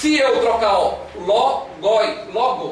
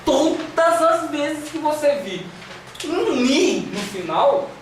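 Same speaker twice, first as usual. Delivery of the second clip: slow, higher-pitched, drawn-out emphasis; slow emphatic repetition; 5 semitones higher, slower than usual. Shown as a demonstration. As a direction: spell out the rule slowly for the class. Teacher drills rule by repeating it.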